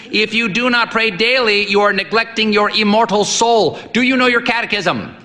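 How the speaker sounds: high, booming, lecturing; stern old priest. A narrator's reading. Old priest lecturing the boy on prayer, booming. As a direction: preach at the boy.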